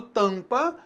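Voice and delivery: "tampa" with a clearly nasal first vowel; nasalised vowel. Shown as a demonstration. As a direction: send the AM of "tampa" through the nose. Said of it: "eu tenho a nasalização".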